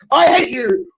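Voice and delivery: real tearful shout of hatred; crying shout. Real, not acted. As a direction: yell it through tears. Outburst in heated stream argument.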